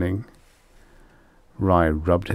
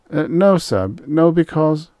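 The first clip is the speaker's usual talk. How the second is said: high, louder, flustered stammer; nervous denial; 10 semitones higher, 5 dB louder than usual. A narrator's reading. Rye answering whether he fears the beasts, nervous. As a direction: protest haltingly in a raised, anxious pitch. Frightened hunter denying to his boss.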